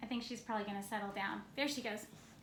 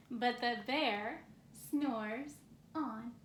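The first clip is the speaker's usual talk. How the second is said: put-on reading to a child. Parent reading a bedtime book to a sleepy baby, gently. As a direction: read it like a bedtime story to a baby.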